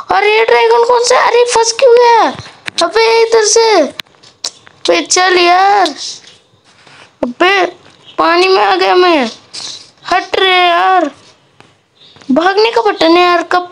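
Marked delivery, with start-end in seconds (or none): 0.1-11.1 high, frantic gamer panic; high frantic complaints while fleeing; panicked. Real, not acted. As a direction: scramble away in a panic. Stuck fleeing the dragon.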